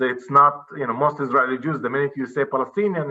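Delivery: ordinary delivery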